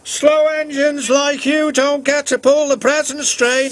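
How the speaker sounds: high, scornful engine voice; snorting James. A narrator's reading. James brushing off Edward's apology. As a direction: snort it dismissively. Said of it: "snorted James".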